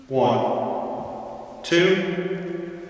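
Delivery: mic check soaked in reverb; heavy reverb. Shown as a demonstration. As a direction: voice drowned in big hall reverb. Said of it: "that's an extreme amount of reverb".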